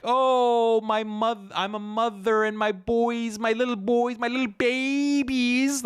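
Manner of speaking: high, whiny mocking falsetto of a doting mother. Mocking a reformist woman pleading about her little boys. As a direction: ham up a fretting mother's plea. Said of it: "She appealed to, like".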